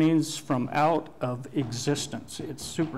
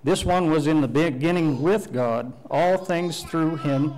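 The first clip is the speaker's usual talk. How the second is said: scripture reading; 2 semitones higher, 5 dB louder than usual. Acted. Preacher proclaiming the verse to the congregation, loud and solemn.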